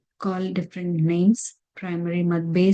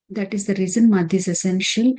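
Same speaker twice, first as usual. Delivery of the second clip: normal talking voice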